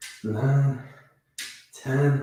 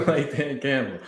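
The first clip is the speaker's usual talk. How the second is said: laughing through the words; 4 semitones higher than usual. Real, not acted. Joking to chat about his push-up form, laughing. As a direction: giggle out the comparison.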